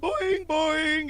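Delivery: high, drawn-out cartoon boings; bouncy spring noise, pitched high; imitated boing. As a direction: sing out a slow springy boing. Imitating a springy sound effect.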